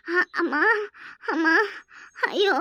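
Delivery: put-on moans of pain; feigned pain. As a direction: groan out short cries as if the belly hurts. Fakes belly pain to be noticed. (வலியில் துடிப்பது போல் முனகல்)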